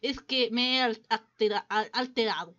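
put-on flustered, stammering French accent; bad French accent. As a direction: stumble over Spanish with a hammed-up French accent. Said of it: "perdonen mi mal acento". (mal acento francés, alterado)

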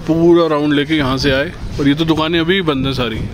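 normal talking voice

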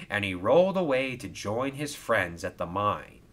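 ordinary delivery

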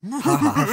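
high, loud villain cackle; evil laugh. Acted. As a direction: cackle in triumph like a demon who has won. Villain gloats over deceived girl.

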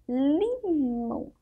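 "limão" very slow, the li held long before mão; drawn-out syllables. Shown as a demonstration. Teacher introducing the syllable li, drawn-out syllables. Teacher draws out the example word.

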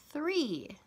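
teacher modeling three; modeled word. Shown as a demonstration. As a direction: model three slowly for repeating. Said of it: "Second word is three".

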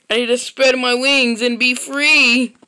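ordinary delivery

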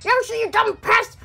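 angry yell. Acted. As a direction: yell at the pest in fury. Angry at Yoshi ruining invention.

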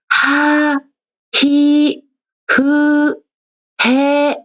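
하행 청음 시범; ha row read. Shown as a demonstration. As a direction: model 하 히 그 해. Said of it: "이렇게 발음을 하는 걸".

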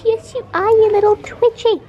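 high cooing pet voice. Real, not acted. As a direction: gush over the bunny in a high voice.

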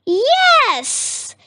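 very high, jubilant exclamation; delighted cry. A narrator's reading. Witch sees the new broom, crying out high in delight. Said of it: "cried the witch".

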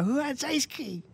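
put-on high, slow childlike voice; kid voice. Shown as a demonstration. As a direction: ask it like an excited little kid. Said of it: "Can you say that now?".

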